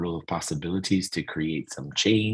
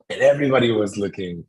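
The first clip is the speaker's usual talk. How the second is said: ordinary delivery, nothing marked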